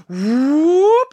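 high rising loud whoop; whoop sound. A narrator's reading. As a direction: pop it out like a sound effect. Nose grows sound effect.

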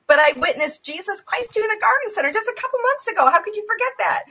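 high, hurried disbelief; incredulous protest. Retold or imitated. Woman reminds the forgetful greeter of their meeting, incredulous. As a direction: rush it out high, as if affronted.

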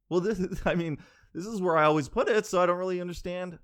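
fake-casual nervous laughing accusation; psycho laugh. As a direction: laugh like you're about to lose your mind. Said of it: "those psycho laughs".

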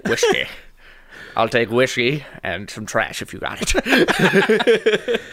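slow mock Sean Connery drawl, whiskey slurred to wishkey; slow hammed-up Scottish film-star voice; Sean Connery raccoon. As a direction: lean into a lazy Connery impression. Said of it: "Sean Connery raccoon".

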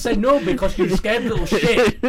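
high, loud laughing taunt; mock him through laughter; laughing tease. Real, not acted. Teasing friend with a laugh.

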